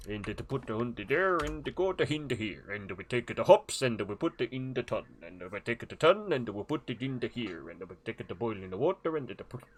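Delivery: bouncy sing-song mock-Swedish patter; comic Swedish accent with extra syllables; Swedish Chef voice. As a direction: run the steps together in a lilting fake Swedish accent. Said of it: "Swedish chef".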